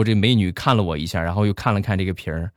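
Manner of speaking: ordinary delivery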